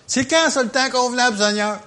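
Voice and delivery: a believer pressing the Lord when; impatient believer. Pretend.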